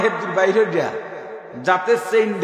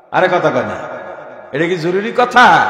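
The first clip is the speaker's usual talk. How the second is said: loud rhetorical demand; 6 dB louder than usual. Real, not acted. Preacher presses audience loudly.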